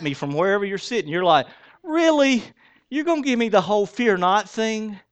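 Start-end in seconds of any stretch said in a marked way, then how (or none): 1.8-5.0 high-pitched disbelief; indignant listener pushing back, pitched well up; incredulous. Acted. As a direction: throw it back in mock outrage. Acted indignant listener reply.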